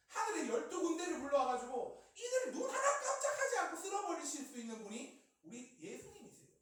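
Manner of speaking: loud, high, emphatic proclamation; forceful preaching. Real, not acted. (힘주어 외치는 설교조)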